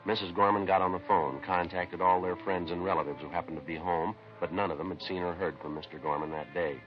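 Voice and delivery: steady, matter-of-fact police report voice; case narration. Acted. Detective telling listeners the case, matter-of-fact narration. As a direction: lay out the facts like a logbook entry.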